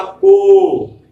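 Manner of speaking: को said clearly and loudly as a model; को stretched for learners; pronounce syllable को. Shown as a demonstration. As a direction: call out को like a teacher to the class. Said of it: "क्या इसका उच्चारण होगा".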